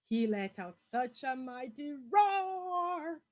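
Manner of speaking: slow, loud build to roar; big roar line. A narrator's reading. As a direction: boom it out slowly. The dinosaur roars.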